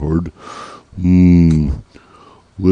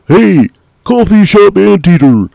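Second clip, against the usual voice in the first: calling out; 10 semitones higher, 3 dB louder than usual. Acted. Calling out to the anteater.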